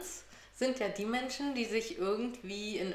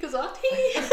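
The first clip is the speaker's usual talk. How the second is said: bursting into high giggles; laughing; 13 semitones higher, 12 dB louder than usual. Real, not acted. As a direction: giggle through the words. Laughs at own word Glied.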